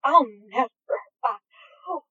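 broken off, breathless and overwhelmed; breathless murmur. A narrator's reading. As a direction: lose the words mid-sentence. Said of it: "Aziraphale murmured".

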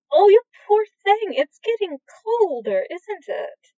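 high, affectionate coo to a pet; tender cooing. Acted. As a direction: fuss over him like a cold kitten. Fondly cooing over the snake.

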